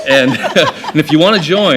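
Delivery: laughing loudly through words. Real, not acted. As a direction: laugh while inviting them. Laughing about secret society.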